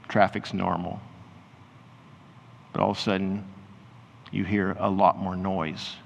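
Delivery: guided imagining. Acted. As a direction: ease them gently into the scene, unhurried.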